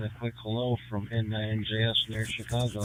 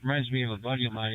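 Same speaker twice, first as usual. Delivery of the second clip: usual speaking voice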